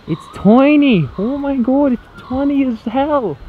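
high, drawn-out squeal on tiny; delighted squeal. Real, not acted. Vlogger discovers a tiny model village, delighted high squeal. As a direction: squeal at how cute and small it is.

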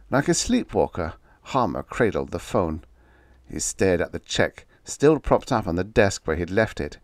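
ordinary delivery

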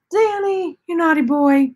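high-pitched pet-talk scolding; playful scolding. Real, not acted. Owner to naughty cat who opens doors, playful scolding. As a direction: coo a mock telling-off, pitched high.

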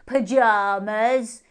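loud, slow hare retort. Acted. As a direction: fire back smugly.

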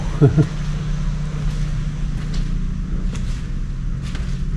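extended airy laughter under the breath. Real, not acted. Long laugh at restroom.

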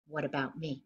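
usual speaking voice

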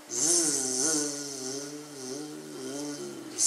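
soft snoring zzz; snoring Tortoise. Acted. Tortoise sleeping through the music, soft snores. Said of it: "said Tortoise".

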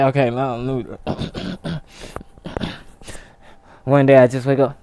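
ordinary talk

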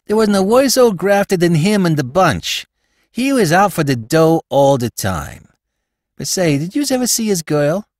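New York slang, pleased with himself; Spike, slangy New York crook. Acted. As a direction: play a cheeky small-time crook.

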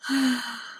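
slow breathy exhale; long sigh. Real, not acted. Calming nerves before a livestream, sighing out. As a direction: let out a settling sigh. Settling nerves while meditating.

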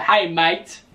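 mock British accent, slightly Australian; English accent slipping Australian. Host mimicking British tea drinkers, accent drifting Australian. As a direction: imitate the Brits, landing nearer Australian. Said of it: "That's, that was more Australian".

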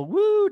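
high whooping "woo"; mock your own high-energy whoop; imitated excited woo. Host imitates his own over-the-top energy, excited whoop. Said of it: "because I just go".

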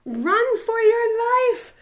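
high, loud, slow yell; panicked shout. Acted. Terrified prairie dog shouting to the others to flee. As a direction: shout it high to warn everyone.

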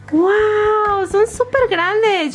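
high, slow amazed gush; excited wow. Real, not acted. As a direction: squeal with delight at the size. Surprised by big toys.

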